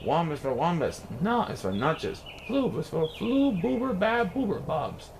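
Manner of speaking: plain reading voice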